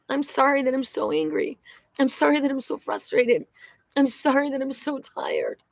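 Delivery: acted plea for forgiveness, rising in force; tearful apology, re-enacted. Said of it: "I cried, and I said to Spirit".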